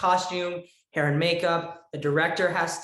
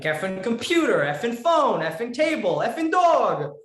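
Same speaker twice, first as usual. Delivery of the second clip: loud, high swearing in Italian accent; emphatic Italian-accented drill, rising to a shout; demo Italian accent; 7 semitones higher, 6 dB louder than usual. Shown as a demonstration. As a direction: practice cursing with an Italian accent, building higher.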